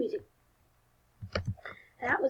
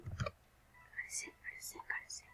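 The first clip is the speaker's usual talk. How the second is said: soft unvoiced urgent whisper; quick hushed self-instruction; 19 dB quieter, faster than usual. Real, not acted. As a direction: hiss it to yourself to stay hidden. Hiding from the murderer.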